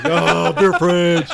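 high, loud, slow drawn-out delight; dreamy delight. Retold or imitated. Driving home salivating, high slow delighted moan. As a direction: savour the thought of a cold beer. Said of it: "It's like".

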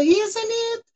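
high, lilting Canadian question; sotaque canadense cantado. Retold or imitated. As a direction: mimic the Canadian sing-song, rising high. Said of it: "ele dá aquela cantada". (pergunta cantada com sotaque canadense, bem aguda)

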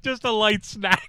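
slow ironic understatement; slow, mock-innocent snack claim; sarcastic deadpan. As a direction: play it dry as a joke. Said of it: "Are you serious?".

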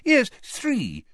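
high, fussy official voice; Fudge voice. Acted. Fudge answering the Prime Minister in a high fussy voice. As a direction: play him as a flustered minister.

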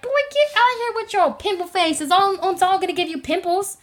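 high-pitched sibling scolding; indignant comeback. Retold or imitated. Sister snaps back at brother's fat jibe, indignant. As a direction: shoo him off with mock outrage.

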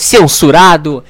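low, slow announcer bleep; deep censor voice. Acted. Radio show censors a curse word in a deep slow voice. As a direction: intone it like a deep censor stamp.

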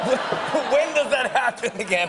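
giggling incredulous query; laughing disbelief. Real, not acted. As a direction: ask through laughter, amazed.

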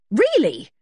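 high, loud, cross word; indignant outburst; fierce exclamation. A narrator's reading. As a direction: exclaim in sharp exasperation. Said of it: "quite fiercely".

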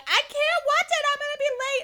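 mock panicked high voice; panicked friend. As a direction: imitate her frantic wail. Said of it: "Cindy was like".

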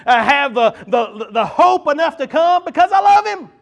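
loud, high, fervent preacher's call; preaching. Acted. Pastor to congregation, preaching loud and fervent. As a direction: pour it out like a revival preacher.

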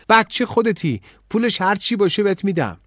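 high, loud indignant retort; shouted. A narrator's reading. Girl to dismissive café owner, indignant shout. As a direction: snap back at full voice. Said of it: "اما داد زد".

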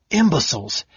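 high snarling insult; snarled. A narrator's reading. Kitiara snarling at the mages' folly. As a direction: spit it out in contempt. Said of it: "Kitiara snarled".

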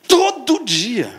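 "todo dia" loud, high and slow; stress on todo dia. Acted. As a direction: lean hard on "todo dia". Emphatic repetition.

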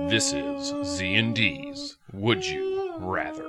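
high, slow announcer voice; segment announcer. Acted. Host introducing the Would You Rather segment, slow announcer voice. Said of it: "announcing segment title".

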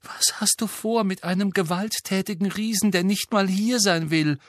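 put-on high, dismayed girl's voice; Hermine voice. Hermine exasperated.

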